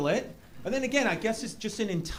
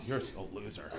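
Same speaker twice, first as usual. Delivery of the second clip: low, very soft aside; muttered insult; 10 semitones lower, 11 dB quieter than usual. Retold or imitated. Court attendant judging the demanding player, low mutter. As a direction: mutter it under your breath.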